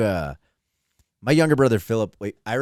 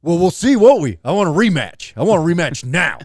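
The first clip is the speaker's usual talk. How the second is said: put-on high, louder defiant kid; mock defiant kid; 6 semitones higher, 6 dB louder than usual. Little brother challenging the grown man, mock defiant. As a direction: sass back like an angry kid. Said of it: "And he's like".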